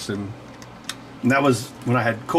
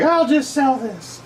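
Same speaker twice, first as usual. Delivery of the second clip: mom's voice; 12 semitones higher, slower than usual. Retold or imitated. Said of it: "but she was like".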